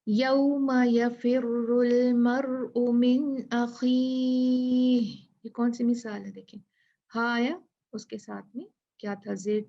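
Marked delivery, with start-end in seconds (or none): 0.1-5.1 very slow, chanted Arabic recitation; slow melodic Quranic chant. Acted. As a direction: recite it in slow, measured tajweed.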